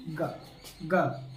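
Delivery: ग said softly and low, with barely any force; said very lightly. Shown as a demonstration. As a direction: breathe out a gentle, quiet ग. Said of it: "एकदम हल्के से बोला गया".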